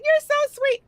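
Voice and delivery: mock sweet falsetto reply; high mock feminine voice. Friend in drag replies.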